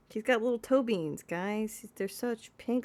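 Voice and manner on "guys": normal talking voice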